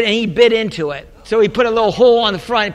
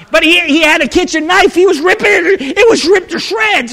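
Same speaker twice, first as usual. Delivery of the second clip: higher, louder, indignant; worked-up retelling; 7 semitones higher, 5 dB louder than usual. Retold or imitated. Pastor describing his son knifing the phone, worked-up and loud. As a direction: pile on the outrage.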